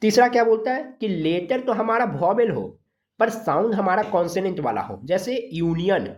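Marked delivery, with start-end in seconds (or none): none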